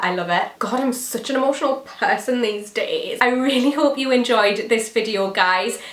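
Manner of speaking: ordinary delivery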